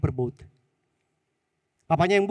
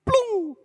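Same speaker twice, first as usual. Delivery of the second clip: high mock plunk of a stone; playful plop sound; splash sound; 3 dB louder than usual. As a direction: make the splash noise. Imitating rock splash.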